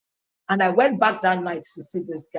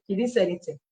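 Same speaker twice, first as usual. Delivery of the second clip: ordinary delivery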